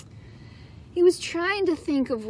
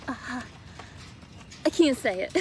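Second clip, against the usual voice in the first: giggling; 4 semitones lower, 8 dB quieter, much slower than usual. Acted. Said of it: "giggled the rhino".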